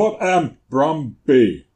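high, loud, slow query; hammed-up deliberate question; mock voice command. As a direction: bellow it slowly at a search assistant. Mock question put to Google.

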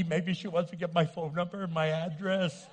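ordinary talk, nothing marked

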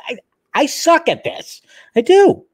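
high-pitched comic confession; self-deprecating squeal. Real, not acted. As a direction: jump up high, insisting on your own failings.

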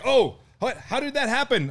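high-pitched mock bewilderment; high retold "oh no" reaction; imitated shocked dismay. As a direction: imitate someone aghast, pitch way up.

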